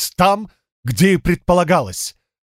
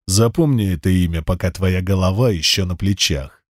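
low menacing threat; 7 semitones lower than usual. Acted. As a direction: warn him in a low menacing voice. Wrestler threatens cyclops.

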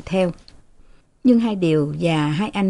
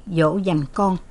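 ordinary delivery

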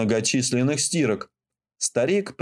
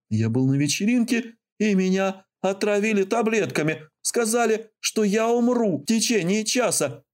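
high-pitched frantic pleading; desperate begging; 8 semitones higher than usual. Acted. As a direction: beg her not to hang up. Frightened teen begging dispatcher.

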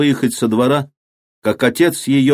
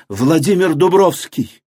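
in despair; 7 semitones higher than usual. A narrator's reading. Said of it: "отвечала Маша в отчаянии".

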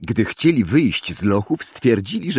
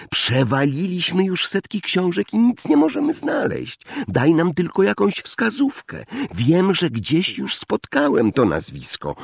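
put-on high-pitched, insistent boyish plea; pleading; 10 semitones higher than usual. A narrator's reading. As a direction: press him for a clue in a raised pitch. Harry pleads with Hagrid for hint. (wysoki, błagalny ton)

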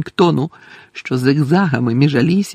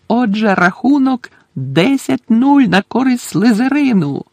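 higher-pitched score announcement; commentator announcing; 8 semitones higher than usual. Acted. Commentator tells the stadium the score, announcing. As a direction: call out the score to the stands.

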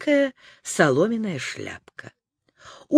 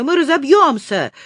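higher-pitched cry of alarm; raised, panicky exclamation; alarmed shout; 6 semitones higher, 4 dB louder than usual. A narrator's reading. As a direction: cry out that disaster is coming. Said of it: "кричал Незнайка".

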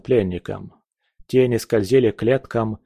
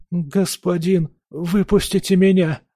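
high, frightened pleading voice; trembling plea; 8 semitones higher than usual. Acted. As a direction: beg like a terrified prisoner. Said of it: "прошептал он дрожащим голосом".

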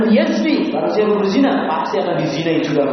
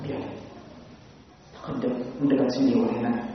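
softer, gentle calming words; gentle prophet voice; 3 semitones lower, 7 dB quieter, faster than usual. Retold or imitated. Prophet gently calms the companions and calls the youth near. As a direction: hush the crowd gently and invite him closer. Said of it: "dengan lembutnya mendiamkan sahabat".

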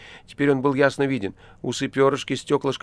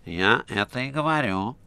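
nasal voice. A narrator's reading. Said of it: "прогнусил Рыжий".

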